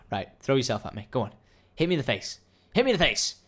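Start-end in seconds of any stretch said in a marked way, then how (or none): 2.7-3.4 insistent dare. Pretend. Repeated dare, growing.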